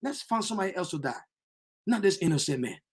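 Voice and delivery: suddenly soft, quick pleading; hushed plea. Acted. Pilate quietly pleading with the crowd, hushed. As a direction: drop to a near hush and plead. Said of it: "He begged them".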